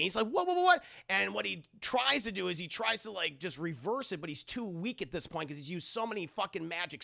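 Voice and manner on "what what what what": high, loud stammer of alarm; acted frantic disbelief, pitched way up; panicking. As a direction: repeat it in a rising mock panic. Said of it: "he starts panicking".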